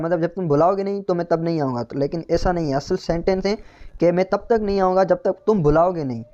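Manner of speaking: ordinary talk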